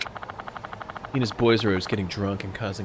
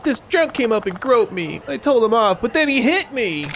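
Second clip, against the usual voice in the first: high, loud dubbed damsel voice; overacted anime dub; 13 semitones higher, 4 dB louder than usual. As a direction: ham up a distressed anime girl. Voicing woman in game dub.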